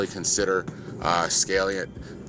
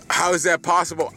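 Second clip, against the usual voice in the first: amazed; 9 semitones higher, 4 dB louder than usual. Real, not acted. Amazed at feat.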